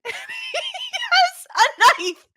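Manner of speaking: high-pitched laughing retelling; can barely get the words out for laughing; real giggles breaking up the story; laughing through it. Real, not acted. Retelling Larry's knife entrance.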